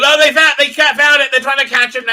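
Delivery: loud, high, urgent news; retold excited neighbour. Neighbour phoning about the found dog, excited and urgent. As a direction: blurt it out like an excited caller.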